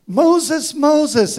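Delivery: loud, high call across a distance; God summoning Moses loudly. Acted. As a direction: call his name out strongly twice.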